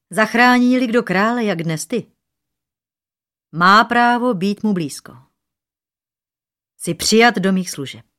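high, loud kingly decree; royal proclamation. A narrator's reading. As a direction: proclaim it as a grateful king. King proclaims reward to his rescuer. (slavnostní královské prohlášení)

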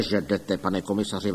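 usual speaking voice

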